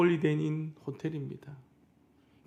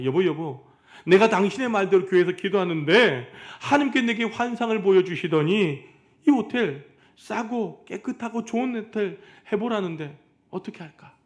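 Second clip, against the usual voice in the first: higher and louder, rushing with excitement; excited husband; 5 semitones higher, 6 dB louder than usual. Retold or imitated. Husband telling his wife about the dream, excited and loud. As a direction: burst in bursting with the news.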